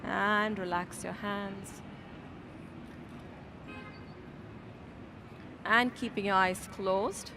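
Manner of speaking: yoga teacher guiding the class into meditation, softly; guided meditation. Acted. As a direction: settle them in, low and gentle. Leads into seated meditation.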